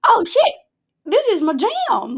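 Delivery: high, loud thrilled outburst; excited kid. Retold or imitated. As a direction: shout it like a kid who just got power. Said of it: "And I'm like".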